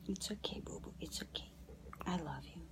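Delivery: tender whispered comfort; soothing whisper. Real, not acted. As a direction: hush her gently back to calm. Soothing fussy baby after burp.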